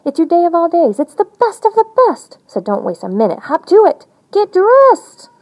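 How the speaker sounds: high, bright-eyed bird urging you up; eager birthday bird calling you to hurry; excited bird voice. Acted. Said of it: "Then the bird says".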